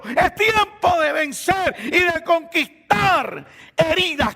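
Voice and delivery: high, building pulpit shout; impassioned rallying cry; full-force preaching. Real, not acted. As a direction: drive each call home with rising force.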